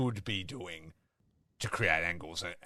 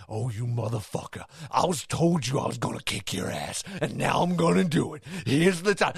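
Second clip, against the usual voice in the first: imitating wrestler's threat; 6 semitones higher than usual. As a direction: act out a wrestler's threat, rising in pitch and volume. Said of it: "he's literally talking like that".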